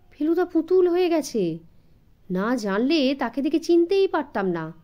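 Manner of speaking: usual speaking voice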